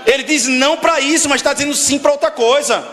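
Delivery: preaching. Acted. Preaching at rising force.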